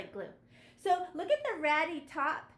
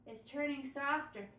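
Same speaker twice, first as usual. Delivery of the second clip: normal talking voice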